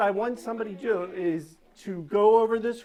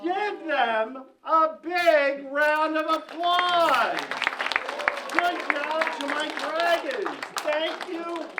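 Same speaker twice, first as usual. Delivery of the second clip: grandiose flea circus showman; 8 semitones higher, slower than usual. Acted. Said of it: "showman applause call".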